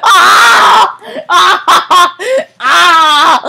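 shrieking laugh-scream. Real, not acted. Player dies in game, screaming.

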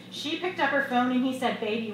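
usual speaking voice

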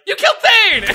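shouting. Retold or imitated. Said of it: "was like".

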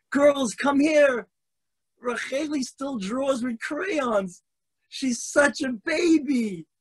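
put-on high, slow, taunting girl's voice; mocking classmate. Girl calls classmates to mock Racheli, high slow taunt. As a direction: sneer it out to the class.

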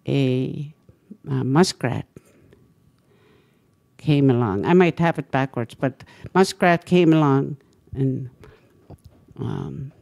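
ordinary talk, nothing marked

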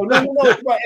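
hammed-up caller correcting. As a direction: insist like an annoyed customer. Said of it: "And the guy would go".

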